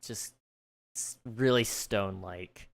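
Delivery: normal talking voice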